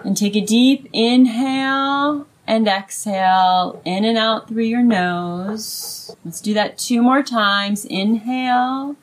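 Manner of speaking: guided meditation. Acted. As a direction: ease them into slow breaths. Leads breathing exercise.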